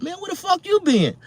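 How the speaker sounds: very high loud incredulous jeer; mock him, high and loud; mocking passenger. A passenger on the bus jeers at the newcomer, loud and high.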